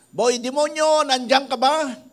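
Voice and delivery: high, loud call toward a distant house; calling out from afar. Retold or imitated. Pastor calling for Boy Demonyo from afar, loud shout. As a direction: shout his name from down the path. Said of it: "malayo pa lang sumisigaw na ako".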